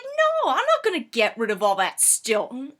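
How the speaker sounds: mock-defiant friend; stubborn refusal. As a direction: refuse point-blank. Friend refuses to dump cheese.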